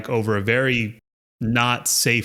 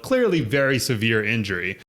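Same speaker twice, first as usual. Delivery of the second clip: ordinary delivery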